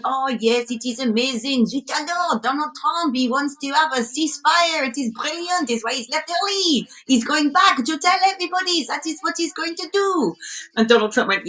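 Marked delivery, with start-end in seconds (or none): 0.0-10.3 put-on higher-pitched caricature French accent; gushing mock-French politician, pitched up; hammed-up French accent, singing someone's praises; Emmanuel Macron. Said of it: "G seven who's standing going".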